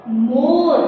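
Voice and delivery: मोर said low with a plain o; model word. Shown as a demonstration. Teacher correcting students' spelling of मोर. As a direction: say मोर with a simple ओ, not औ. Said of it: "एक मात्रा है, ओ की मात्रा है".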